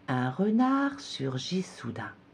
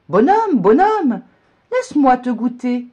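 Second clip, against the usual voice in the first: much higher, very loud call; cow calling out; 10 semitones higher, 10 dB louder than usual. A narrator's reading. Cow in the meadow calling after the gingerbread man, loud and high. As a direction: holler after the runaway. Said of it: "Elle lui crie".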